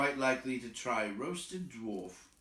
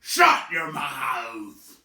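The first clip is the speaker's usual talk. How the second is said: loud, slow choked shout; choking troll snarl; 4 semitones higher, 14 dB louder, much slower than usual. Acted. William snapping at the troll after choking on drink. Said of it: "William choked".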